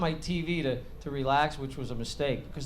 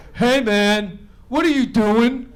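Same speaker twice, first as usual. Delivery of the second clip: son's casual voice; 6 semitones higher, 10 dB louder, slower than usual. Retold or imitated. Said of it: "He's like".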